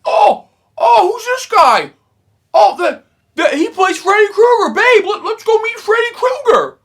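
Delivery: squeaky high, loud clueless voice; mock the clueless latecomer in a silly falsetto; mocking casual fan. Mocking the walk-in who got to meet Freddy, squeaky falsetto. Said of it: "and he goes".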